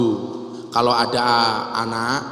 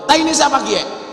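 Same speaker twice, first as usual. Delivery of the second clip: high-pitched mock outcry; retold Ngapak shout; 10 semitones higher than usual. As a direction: cry out indignantly like a Ngapak villager. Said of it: "Orang ngapak teriak". (pura-pura teriak ala orang Ngapak)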